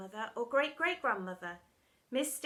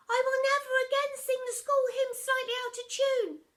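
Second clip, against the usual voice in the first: put-on much higher, nasal child recitation; whiny pupil; 13 semitones higher, 7 dB louder than usual. Pupil reciting punishment line, high child voice. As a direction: squeak it like a small kid.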